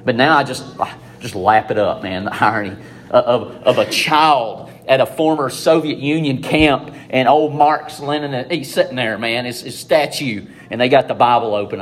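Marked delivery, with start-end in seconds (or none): none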